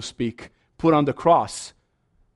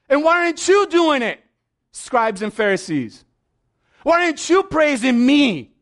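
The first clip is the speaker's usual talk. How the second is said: Jesus challenging; 11 semitones higher, 5 dB louder than usual. Retold or imitated. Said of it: "What does Jesus say".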